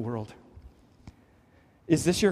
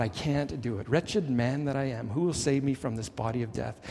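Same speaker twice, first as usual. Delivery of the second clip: softer, lower, a surrendered admission; quiet confession; 4 semitones lower, 5 dB quieter than usual. Retold or imitated. Believer finally admits defeat to God, softly. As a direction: let it drop into a hushed confession to God. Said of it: "finally admit to God".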